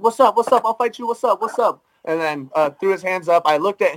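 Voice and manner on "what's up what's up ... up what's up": higher-pitched mock challenge, rapid repeats; confrontational kid. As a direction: imitate a kid squaring up for a fight. Aggressive kid confronts him, retold.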